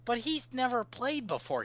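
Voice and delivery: high-pitched character protest; protesting over the shoulder. Acted. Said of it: "he says over his shoulder".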